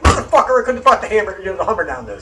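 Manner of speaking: loud, high outburst of frustration; retold angry outburst; pissed off. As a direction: curse it out, fuming at the sign. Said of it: "So I'm pissed".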